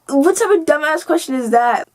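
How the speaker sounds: retold indignant outburst at a dumb question; scornful comeback. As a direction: throw it back in disbelief.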